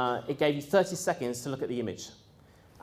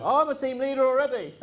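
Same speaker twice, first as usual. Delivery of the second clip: high, louder laughing brag; mocking boast; 12 semitones higher, 3 dB louder than usual. Trainees bragging to trainers, mocked with a high laugh. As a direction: mimic the smug claim while laughing. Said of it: "people going".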